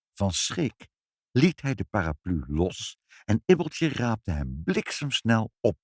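plain reading voice

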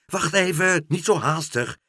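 high, loud halting call. A narrator's reading. Meneer Pen stops hasty Karel, calling out. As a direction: call someone back urgently. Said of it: "riep meneer Pen".

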